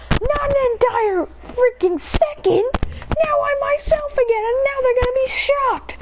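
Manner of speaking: high-pitched crying complaint; a boy wailing at God through tears; tearful high wail. Acted.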